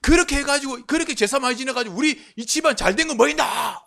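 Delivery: high, loud angry scolding; retold furious shout about the ancestral rites; angry outburst. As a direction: lose your temper at the family. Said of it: "막 성질 내면서요".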